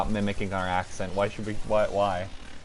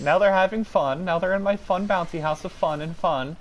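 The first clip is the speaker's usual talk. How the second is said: mock glee; 9 semitones higher, 4 dB louder than usual. Mocking chaotic bouncy castle.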